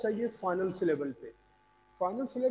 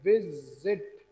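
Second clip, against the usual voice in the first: Visit said slowly, vi-zit; visit broken slowly into two beats; split into syllables; 3 semitones lower, 4 dB louder, much slower than usual. Shown as a demonstration. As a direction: draw out both syllables of visit. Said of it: "दो syllables use किए".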